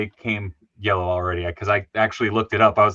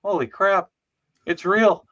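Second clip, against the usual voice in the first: excited amazement; 9 semitones higher than usual. Retold or imitated. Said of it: "you just sit there and go".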